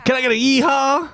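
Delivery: high, excited call-out; hype call. Acted. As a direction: pump up the crowd, pitched high. Hyping co-hosts.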